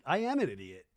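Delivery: normal talking voice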